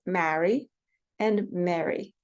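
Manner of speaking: MARRY with 'a' of cat, MARY with 'eh'; two distinct vowels. Shown as a demonstration. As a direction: contrast MARRY "ma-ree" and MARY "meh-ree", slowly. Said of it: "those are two distinct sounds".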